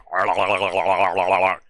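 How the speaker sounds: wet gargle with the tongue curled back; gargling. Real, not acted. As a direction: gurgle it out, rolling la-la-la. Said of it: "so you just gargle my own saliva".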